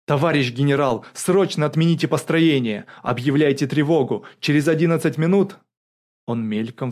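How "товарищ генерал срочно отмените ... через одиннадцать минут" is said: loud, higher, urgent plea; blurted out. A narrator's reading. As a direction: blurt the warning to a superior. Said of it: "Богданов выпалил". (выпалить, громко и срочно)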